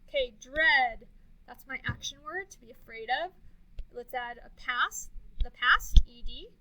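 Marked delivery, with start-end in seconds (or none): none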